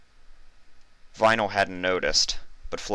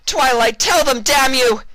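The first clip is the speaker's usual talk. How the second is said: shrill desperate screech, much higher and louder; condemned mare screeching at Twilight to save her; 9 dB louder than usual. Acted. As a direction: shriek your innocence at the guillotine. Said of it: "she screeched".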